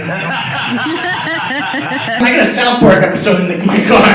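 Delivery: loud, slow laughing. Real, not acted. Comic loud laughing about the cab. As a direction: laugh through the words.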